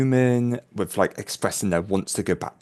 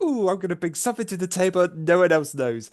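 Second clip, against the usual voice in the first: high, quick, gleeful little boast; self-mockingly pleased; 8 semitones higher, faster than usual. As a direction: act out being quite happy with yourself. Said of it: "I was quite happy with myself".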